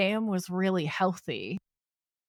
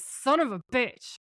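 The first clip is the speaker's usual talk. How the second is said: higher, slow, dragged-out mock fury; retold angry curse; 5 semitones higher than usual. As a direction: spit the curse out slowly, pitched up in a half-acted rage. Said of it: "who's really angry now".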